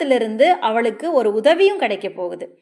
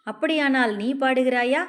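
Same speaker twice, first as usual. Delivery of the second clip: plain reading voice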